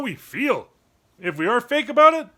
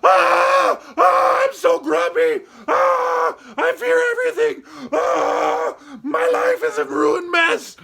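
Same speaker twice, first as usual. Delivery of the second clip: much higher, loud hammed-up wailing; over-the-top emotional yelling; 8 semitones higher, 4 dB louder, slower than usual. Showing an unacceptable level of emotion, hammed-up yelling. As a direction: go crazy with every emotion at once. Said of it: "crazy and going".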